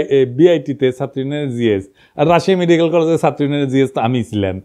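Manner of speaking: normal talking voice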